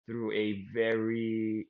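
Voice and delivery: normal talking voice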